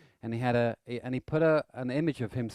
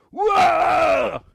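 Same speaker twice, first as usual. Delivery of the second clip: a sudden, high, loud "Whoa!"; startled shout; 14 dB louder than usual. Retold or imitated. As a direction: leap back with a big yelp. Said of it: "like that".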